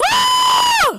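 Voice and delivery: very high loud scream; mock scream. Bhutan getting hit, high mock scream. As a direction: scream like a cartoon. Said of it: "Bhutan was like".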